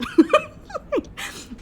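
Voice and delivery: high, loud cackling burst; laughing. Real, not acted. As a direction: crack up in a high-pitched laugh. Laughs at her own joke.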